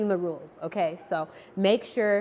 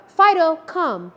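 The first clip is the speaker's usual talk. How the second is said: "Fido, come" called out higher and louder; calling the dog; 7 semitones higher, 7 dB louder, slower than usual. Shown as a demonstration. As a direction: call the dog across the yard, bright and loud. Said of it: "if you call your dog".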